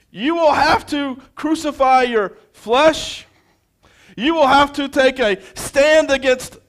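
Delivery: high, loud impassioned sermon delivery building to shouts; full-force preaching. Acted. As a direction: preach it hard, rallying the church not to fear.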